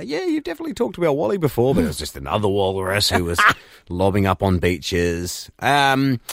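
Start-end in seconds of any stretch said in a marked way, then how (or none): 0.0-1.8 quick, higher know-it-all voice. Retold or imitated. Co-host teasing his partner, fast know-it-all voice. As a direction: rattle it off like a smug expert. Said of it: "be all walrusty and go".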